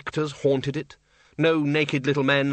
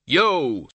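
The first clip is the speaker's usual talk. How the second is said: loud, sharp yo; snapped; 3 semitones higher, 4 dB louder than usual. A narrator's reading. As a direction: bark the greeting. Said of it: "snapped the Dean".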